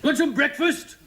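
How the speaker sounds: high, loud copper calling in; loud policeman. Retold or imitated. Policeman calls breakfast to the cell, loud and high. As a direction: bark it like a burly policeman.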